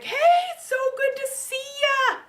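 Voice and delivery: put-on the lonely tree overjoyed to see its boy return; excited tree voice.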